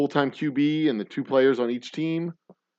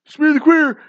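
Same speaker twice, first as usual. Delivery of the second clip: shouted call; 11 dB louder than usual. Retold or imitated. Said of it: "you're like".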